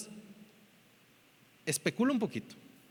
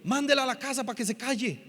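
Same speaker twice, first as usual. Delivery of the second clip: mildly irritated, higher-pitched complaint; annoyed disciples; 5 semitones higher, faster than usual. Retold or imitated. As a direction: imitate fed-up followers. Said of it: "le incomodó a sus discípulos". (discípulos incomodados)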